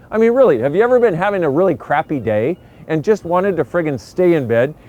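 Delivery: usual speaking voice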